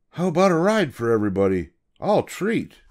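quick, generous offer; man with the yellow hat. Acted. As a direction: treat everybody cheerfully.

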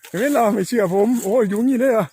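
cheering a lucky find; excited. Real, not acted. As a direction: crow with excitement over the find. Excited at finding the nest.

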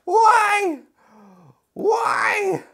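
high anguished wail of protest; upset figure. Pretend. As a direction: cry it out as the upset wrestler, hammed-up. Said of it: "he's upset. Like".

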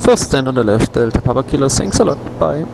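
loud, distorted radio call; over a pilot headset. Real, not acted. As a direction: overdriven cockpit radio.

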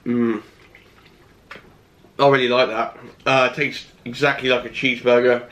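speaking between bites of food; talking while chewing. Real, not acted. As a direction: mouth full of pasta. Seen 1: Cheeks full, jaw chewing pasta bite while speaking at the start.

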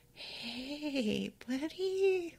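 soft, drawn-out, wary greeting rising high; wary coaxing. Acted. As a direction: gently test the waters with a scary dog. Wary of scary dog, gentle coaxing.

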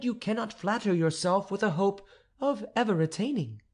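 stately countess scolding a presumptuous suitor; haughty countess. Acted. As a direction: put the upstart tutor firmly in his place. Countess rebukes the tutor.

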